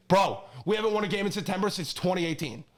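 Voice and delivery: ordinary delivery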